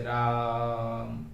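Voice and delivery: राम with the आ held very long; elongated राम; drawn-out Raam. Shown as a demonstration. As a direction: stretch the आ in राम out slowly. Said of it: "उसको काफी देर तक खींचेंगे".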